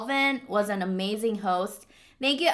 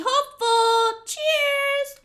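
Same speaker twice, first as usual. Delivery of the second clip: cute high toast; 14 semitones higher, much slower than usual. Real, not acted.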